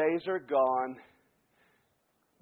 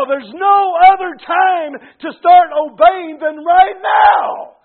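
loud, high preacher's shout, stretching key words; full-force preaching; 8 semitones higher, 16 dB louder, slower than usual. Real, not acted. Preacher urging the congregation to quit now, full-force shout. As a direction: thunder it out like an urgent warning.

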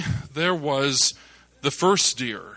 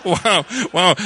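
laughing wow; 4 semitones higher, 6 dB louder than usual. Retold or imitated. Father-in-law amazed at shots.

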